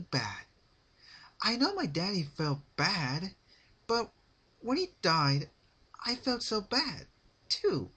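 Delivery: high, loud chirpy character voice with sad undertone; Pinkie Pie voice. A narrator's reading. Pinkie telling Rarity about her father, high chirpy voice. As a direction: chatter on cheerfully about something sad.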